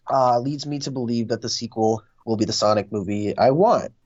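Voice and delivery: usual speaking voice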